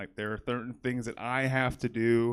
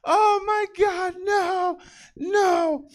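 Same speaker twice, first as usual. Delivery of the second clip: high, loud, drawn-out shrieking; screaming kids; 8 dB louder, much slower than usual. Retold or imitated. Fifth graders screaming about a fart, high and loud. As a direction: shriek in mock panic over a smell.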